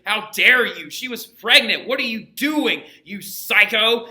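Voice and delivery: son's outraged outburst, acted lightly; retold yelling. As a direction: play the furious son confronting his dad. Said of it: "starts yelling at his dad".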